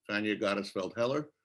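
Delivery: normal talking voice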